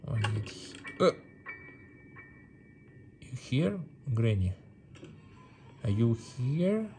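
ordinary delivery

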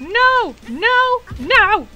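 loud, high yelps of refusal; shouted no. Acted. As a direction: yell it like being chased. Character yelling refusals repeatedly.

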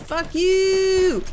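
loud high furious yell; angry shout. Real, not acted. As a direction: yell it right at the enemy. Fighting enemy.

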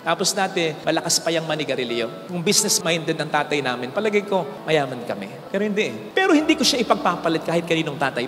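ordinary talk

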